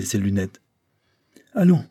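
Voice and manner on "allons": high, coaxing opener; cajoling. A narrator's reading. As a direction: coax lightly, starting high and falling. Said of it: "du ton de la cajolerie". (ton cajoleur, voix haute)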